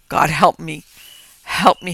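loud desperate plea; sobbing. Acted. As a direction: beg for help in despair. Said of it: "he sobbed".

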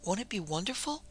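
doting mother dreaming aloud; cooing. A narrator's reading. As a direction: croon it tenderly, lifting at the end. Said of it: "she cooed".

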